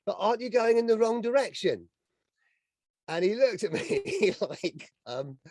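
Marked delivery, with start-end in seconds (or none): 0.1-1.9 joking quip. Retold or imitated. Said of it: "I thought I'd be funny".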